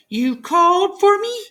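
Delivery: high, squeaky little mouse; a small grateful mouse voice; relieved little mouse squeak; tiny mouse. A narrator's reading.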